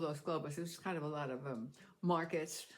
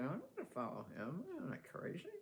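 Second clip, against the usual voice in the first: hushed whisper to herself; whispered aside; 8 dB quieter than usual. Retold or imitated. Whispering to herself while following the policeman. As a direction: whisper it like a secret thought.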